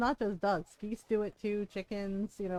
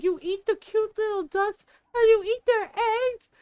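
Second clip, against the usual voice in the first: put-on high, louder, appalled child; shocked little girl voice; 9 semitones higher, 5 dB louder than usual. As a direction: play the outraged eight-year-old. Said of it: "she's just like".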